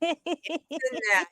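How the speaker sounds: slow, drawn-out Boston internet with no T; Boston accent. Retold or imitated. Mocking Starlight's Boston accent for the chat. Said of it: "she puts it on big time".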